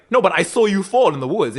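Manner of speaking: loud, high puzzled protest; hammed-up baffled father; mock bewildered father. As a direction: play the confused father, loud. Father surprised.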